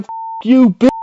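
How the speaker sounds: high shouted curse; mock fury, shouted; retold curse. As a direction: spit it at her.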